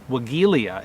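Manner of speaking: Wagelia with a hard g, "wuh-GHEE-lee-a"; another variant pronunciation. Retold or imitated. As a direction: say Wagelia with g as in geese. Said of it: "another way that I've heard it pronounced".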